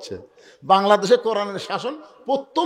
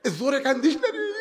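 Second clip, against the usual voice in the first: tearful plea; 7 semitones higher than usual. Acted. Tearful acted plea to labouring woman.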